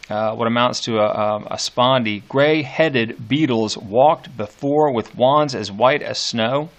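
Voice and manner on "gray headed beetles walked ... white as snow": slow reading with a strong spondee opening; slow scanned reading. Shown as a demonstration. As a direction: open heavy, then let the iambs roll slowly. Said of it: "after this opening".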